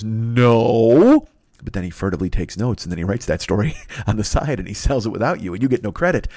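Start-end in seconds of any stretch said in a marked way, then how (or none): 0.0-1.3 a short, clipped, louder "No"; curt no. Pretend. Poe refusing a pitch, a curt no. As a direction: shut it down flatly in one word. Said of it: "Edgar Allan Poe just says".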